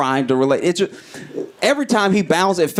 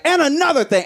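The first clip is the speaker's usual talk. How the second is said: parting shot; 10 semitones higher than usual. Retold or imitated. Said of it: "he's like".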